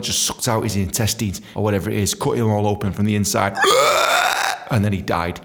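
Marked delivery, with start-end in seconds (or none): none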